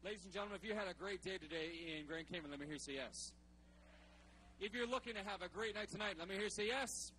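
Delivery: loud hype host; podium speech. Real, not acted. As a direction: fire up the crowd from the stage. Hyping the crowd from stage.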